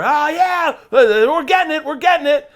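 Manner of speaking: high, loud mock cheering crew; loud excited mock shout. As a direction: crew yelling they're getting the shot. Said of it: "I can hear".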